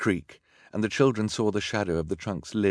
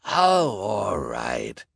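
sighing assent; 4 dB louder, much slower than usual. A narrator's reading. Villain gives in to his girlfriend, sighing. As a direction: give in with a big sigh. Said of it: "Olaf sighed".

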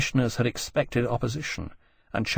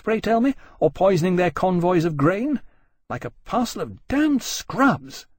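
higher-pitched, scathing tirade; indignant woman officer; 7 semitones higher, 3 dB louder than usual. Acted. Jane accusing the admirals of a creeping business, scathing. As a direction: berate them with mounting contempt.

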